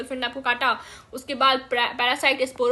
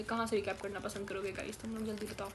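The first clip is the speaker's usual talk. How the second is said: drifting off-mic; 4 semitones lower, 12 dB quieter, much faster than usual. Real, not acted. Quick aside to viewers.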